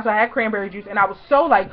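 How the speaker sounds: ordinary talk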